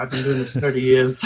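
put-on a half-hearted, laughing stab at an Irish accent; barely-there Irish accent, giving up mid-line; Irish accent attempt. Husband quoting the Irish midwife, faint laughing Irish accent. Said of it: "I can't do an Irish accent".

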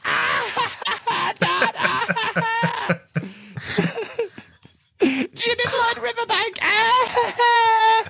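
put-on very high squealing kid voice through laughter; child laughing. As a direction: giggle like a delighted eight-year-old. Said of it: "And I'm like".

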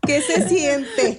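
laughing. Real, not acted. Teasing friends about their age.

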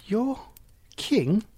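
high-pitched, halting question; astonished. Acted. Boy hears the frog's offer of a crown, astonished. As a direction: echo it in surprise, with a gap between the words.

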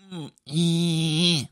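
a small coy "mm, eh" hum, lightly copied; imitated little sound. Imitating the woman's flirty little sound at the register. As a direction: mimic her cute little noise. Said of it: "like that, like that sound".

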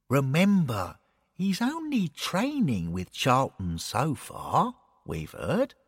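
calm him down gently; Bill, reassuring. Acted. Bill reassuring Derek about the training, soft.

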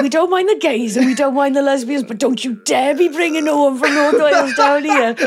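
comic scolding on the edge of laughter; mock indignant warning. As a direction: lay down the law, playfully outraged. Quotes film's scolding joke, laughing.

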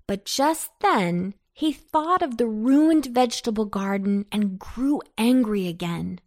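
ordinary delivery, nothing marked

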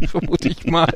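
chuckling through the words; laughing. Real, not acted. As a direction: say it while laughing.

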